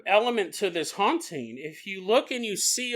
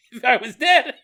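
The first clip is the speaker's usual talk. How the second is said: bird watcher's louder mock outburst with a laugh; hammed-up louder cry; mock shout; 5 semitones higher, 7 dB louder than usual. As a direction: burst out about being dead, half laughing. Bird watcher fantasizing loudly.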